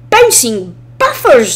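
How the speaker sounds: slowed, alarmed exclamation; a lightly voiced engine caught by surprise; startled cry. A narrator's reading. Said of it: "Duncan cried".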